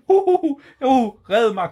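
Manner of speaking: high hammed-up frightened squeals; mock fear. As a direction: pretend to be terrified, squeaking for help. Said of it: "jeg er bange".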